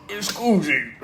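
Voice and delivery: lightly voiced bouncy Tigger; a hint of excited Tigger at the mirror. Tigger after acorns.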